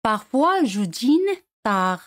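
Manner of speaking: "Parfois je dîne tard" slow and careful; slow French model. Shown as a demonstration. Teacher slowly models the full sentence for learners. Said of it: "teacher models sentence".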